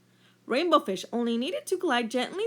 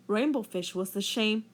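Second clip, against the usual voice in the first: ordinary delivery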